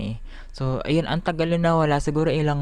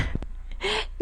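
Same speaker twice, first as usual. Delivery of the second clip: giggle; 6 dB quieter than usual. Real, not acted. Shows gap after tooth extraction.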